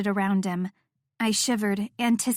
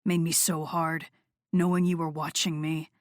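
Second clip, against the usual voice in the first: low male voice; 4 semitones lower than usual. Acted. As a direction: speak low and close. Noah voiced lower.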